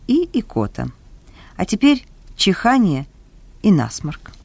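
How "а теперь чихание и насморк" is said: ordinary delivery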